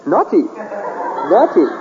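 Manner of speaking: slow, drawn-out scolding of oneself; self-scolding, each word stretched; mock scolding. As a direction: wag a finger at yourself, slowly. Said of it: "cat of nine tails out going".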